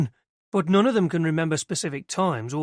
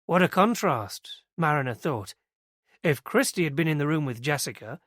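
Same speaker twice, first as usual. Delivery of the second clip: usual speaking voice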